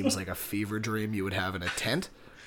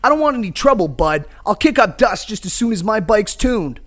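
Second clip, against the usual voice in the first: loud, projected hero retort; defiant biker hero; 10 semitones higher, 13 dB louder than usual. Acted. Biker answers gang leader's threat, defiant. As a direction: stand your ground, punchy and raised.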